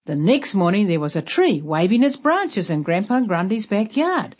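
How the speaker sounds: ordinary delivery, nothing marked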